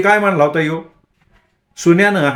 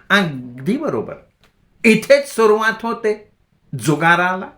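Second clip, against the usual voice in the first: slow then higher; madwoman's triumph; 5 semitones higher than usual. A narrator's reading. Bhagi vindicated before the boys, slow and higher. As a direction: savour it like a mad prophet.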